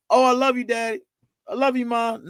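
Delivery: high, sing-song throwaway I love you; mock a kid tossing off a quick I love you; father imitating a careless goodbye; breezy mock I love you.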